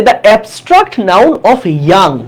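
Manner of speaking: reading the exam item very slowly; slow question read. Shown as a demonstration. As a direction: spell the question out word by word. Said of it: "বলছে".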